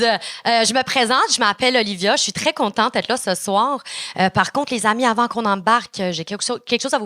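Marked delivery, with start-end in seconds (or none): none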